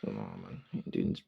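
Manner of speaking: scoffing hush. Retold or imitated. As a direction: wave it off under your breath. Imagined scoffing reply in school.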